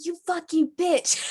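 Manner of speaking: retold curse-out. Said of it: "I cursed at her. I said".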